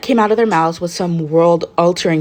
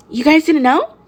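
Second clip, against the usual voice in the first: higher, surprised question; 10 semitones higher, 3 dB louder than usual. A narrator's reading. Mina to the group about Kirishima's hair, higher surprised. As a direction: sound genuinely surprised they didn't know. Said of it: "sounding surprised".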